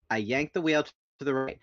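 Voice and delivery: ordinary delivery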